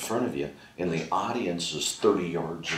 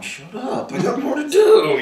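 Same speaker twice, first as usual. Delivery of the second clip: high, laughing plea that gets louder; 10 dB louder than usual. Retold or imitated. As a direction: beg through laughter, mock-frantic. Comic plea to laughing audience.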